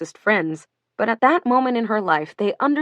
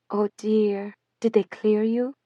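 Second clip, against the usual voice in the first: put-on faint French accent; 3 dB quieter than usual. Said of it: "in her faint French accent".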